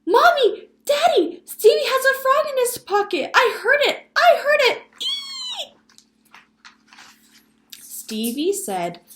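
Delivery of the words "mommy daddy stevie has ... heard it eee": put-on very high, shrieking little girl; loud scream. A narrator's reading. Girl telling parents about brother's frog, screaming. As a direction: scream for your parents in alarm. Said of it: "interrupted by a loud scream from Sally".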